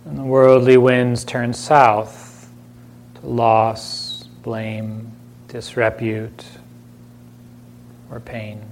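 unhurried meditation voice with long silences between phrases; calm, spacious delivery, leaving room to breathe; guided meditation. Acted. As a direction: gently guide listeners inward, phrase by phrase.

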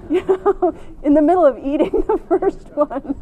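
real laughter breaking through the words; laughing. Real, not acted. Teacher laughing at her own greed while telling the story.

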